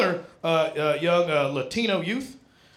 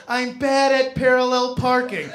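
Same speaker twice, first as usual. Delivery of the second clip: put-on pretty girl excuse; 4 semitones higher, 6 dB louder than usual. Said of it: "did the pretty girl thing".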